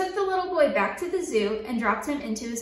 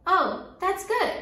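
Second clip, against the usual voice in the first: pleased; 6 semitones higher, slower than usual. A narrator's reading. Refrain, high pleased.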